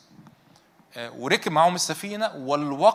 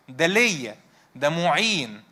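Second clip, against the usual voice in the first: ordinary talk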